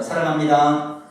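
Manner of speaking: put-on "사랑합니다" said slowly and loudly as a formal old greeting; bow and say "사랑합니다" the old way, slow and loud; old-style greeting. Shown as a demonstration. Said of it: "이거 옛날에 이렇게 많이 했잖아요". (옛날식으로 천천히 크게 "사랑합니다")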